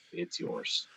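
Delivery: ordinary talk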